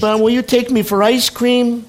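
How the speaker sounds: put-on high little child's plea. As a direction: voice a small grandchild asking. Grandchild asking for ice cream.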